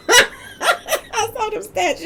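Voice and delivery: high, slow words broken by laughter; laughing through words. Real, not acted. Telling viewers she saw his stats: laughing through words. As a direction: laugh as you say it.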